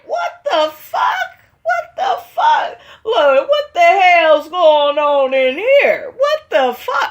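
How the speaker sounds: very high, drawn-out disbelieving shout; hammed-up shock. Recapper replaying own reaction to the outburst, high shocked mock shout. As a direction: re-enact a scandalised reaction, stretching the words. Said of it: "I was like".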